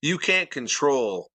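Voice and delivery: ordinary talk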